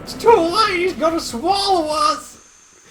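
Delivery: a hint of a young hero; lightly voiced anime hero; Sora voice. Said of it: "Your Sora voice is also on point".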